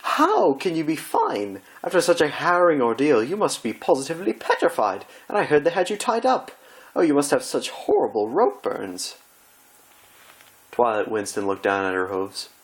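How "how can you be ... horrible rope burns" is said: posh pony fussing over a friend's ordeal; dramatic fretting Rarity. Acted. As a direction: overplay the concern like a stage diva.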